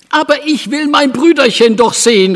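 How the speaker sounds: put-on insist, a child who will not be refused; insistent cry. A narrator's reading. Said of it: "rief Elsbethchen".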